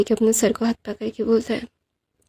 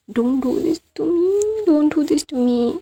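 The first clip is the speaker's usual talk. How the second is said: mock tearful whine; 5 semitones higher, much slower than usual. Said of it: "teary आंखों से".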